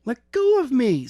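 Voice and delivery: high, loud scream; a girl fighting off captors. A narrator's reading. Isis screaming at her captors, high and loud. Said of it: "screamed Isis".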